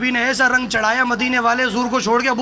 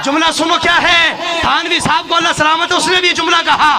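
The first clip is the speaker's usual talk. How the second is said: raised voice, building in force; bold, forceful preaching; 4 semitones higher, 3 dB louder than usual. Real, not acted. Preacher citing a rival scholar's book, boldly forceful. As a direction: declare it boldly, climbing higher as you go. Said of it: "बड़ी धड़ल्ले से बात करने लगा हूँ".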